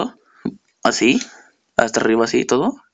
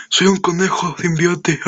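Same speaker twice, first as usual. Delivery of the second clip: slowed, higher cartoon rabbit voice; silly bunny voice; 4 semitones higher, slower than usual. Reviewer voicing the ugly toy as a silly rabbit. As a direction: play the toy as a goofy symbiote bunny. Jokes the figure looks like rabbit.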